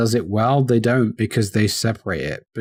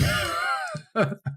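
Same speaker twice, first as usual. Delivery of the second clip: high laugh; 7 dB quieter than usual. Real, not acted. Crack up at the accent.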